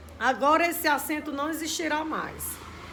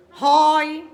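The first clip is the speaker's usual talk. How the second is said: "Rói" punched loud; stressed monosyllable; 2 semitones higher, 9 dB louder than usual. Shown as a demonstration. Teacher showing a tonic monosyllable, "Rói" said loud and emphatic. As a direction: hit "Rói" hard, with an open vowel. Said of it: "é monossílabo tônico".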